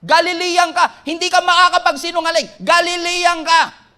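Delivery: nail him with it; accusing. Acted. Accuser catching Peter's accent, loud accusing.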